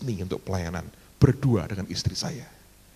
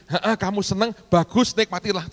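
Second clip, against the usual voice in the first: cheery approval; 8 semitones higher than usual. Retold or imitated. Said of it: "Tidak berkata gini".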